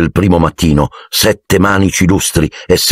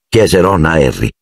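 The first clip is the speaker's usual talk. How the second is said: ordinary delivery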